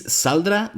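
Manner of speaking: "saldrá" wrongly stressed on SAL; stress on first syllable. Shown as a demonstration. Mocking the meaningless word, first-syllable stress on "saldrá". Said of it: "stress in the first syllable".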